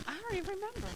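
high, soft claim of not remembering; innocent forgetfulness. Acted. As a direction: brush it off lightly in a high voice. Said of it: "I say now".

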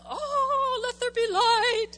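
high, booming mock voice of God; big and dramatic. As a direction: declaim it loudly and theatrically from on high. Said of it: "he wasn't in the big and dramatic".

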